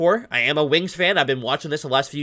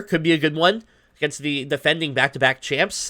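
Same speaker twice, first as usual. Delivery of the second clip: ordinary talk, nothing marked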